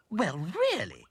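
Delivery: high, loud doll protest; indignant exclamation. A narrator's reading. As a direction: exclaim as if offended. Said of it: "exclaimed Dotty".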